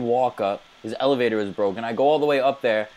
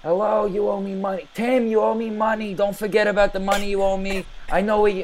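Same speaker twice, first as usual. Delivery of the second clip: higher, sing-song taunting voice; taunting phone call; 7 semitones higher than usual. Retold or imitated. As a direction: needle him over the phone. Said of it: "And I'm always saying".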